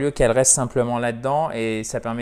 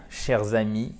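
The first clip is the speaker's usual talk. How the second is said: ordinary delivery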